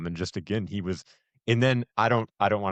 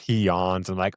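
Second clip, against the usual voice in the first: ordinary talk, nothing marked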